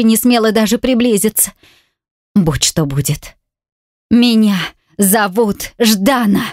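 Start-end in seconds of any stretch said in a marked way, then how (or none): 4.1-6.4 «Меня зовут Ждана» slow, every syllable separated; a woman firmly declaring her true name to Roman; each syllable clear. A narrator's reading. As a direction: spell out «Ждана» deliberately, syllable by syllable. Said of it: "чётко выговариваю каждый слог".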